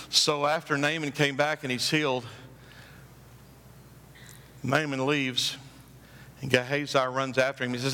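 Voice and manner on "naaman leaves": ordinary talk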